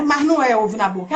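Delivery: usual speaking voice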